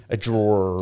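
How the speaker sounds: DRAWER slow and drawn out with heavy r's; Pennsylvania accent. Acted. Friends mocking a Pennsylvania accent, DRAWER with dragged-out r's. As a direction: stretch DRAWER into a long rolling "drawrer". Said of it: "He does do the".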